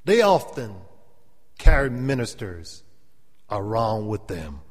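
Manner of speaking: high, loud, slow preaching. Real, not acted. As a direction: lift it like a preacher in full flow. Preaching builds.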